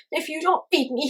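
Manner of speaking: high, loud tearful threat; furious orphan boy yelling; angry yell. A narrator's reading. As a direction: yell it through angry tears. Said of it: "he yelled".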